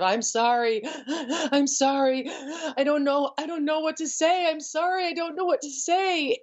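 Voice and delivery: high, choked, gasping apology; imitating a woman sobbing through her apology, mostly mild; retold sobbing apology. Woman breaking news to a child, high gasping sobbed apologies. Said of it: "she was crying so hard that she sounded like she was choking".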